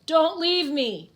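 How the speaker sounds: trapped man wailing not to be left; wailing plea. Acted. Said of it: "the Undertaker wails".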